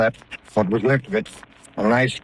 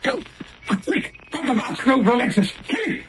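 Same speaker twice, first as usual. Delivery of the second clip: high frantic cries, much higher; panicked shouting; 12 semitones higher than usual. Acted. Captain screams in panic as the cobra strikes. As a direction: yell in panic as the snake strikes.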